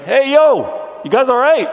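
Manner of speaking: high, loud, casual holler; calling out. Pretend. Jesus hailing disciples while walking toward them on water, calling out. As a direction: hail friends from a distance.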